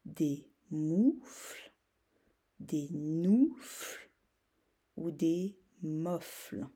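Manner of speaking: des moufles, des noufles, des mofles drawn out very slowly; slow choices. Shown as a demonstration. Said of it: "la bonne syllabe". (choix très lents)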